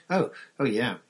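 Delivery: high, flustered, caught off guard; jolted out of his thoughts; startled. Acted.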